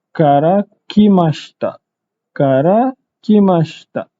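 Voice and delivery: karakimashita said slowly, shi almost silent. Shown as a demonstration. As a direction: draw out karakimashita with a clipped shi. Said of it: "panjang sikit lah cara bacaan dia". (sebut karakimashita perlahan, shi hampir tak kedengaran)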